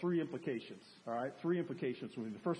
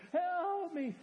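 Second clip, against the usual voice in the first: mock cry for help; 12 semitones higher, 3 dB louder than usual. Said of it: "we're crying".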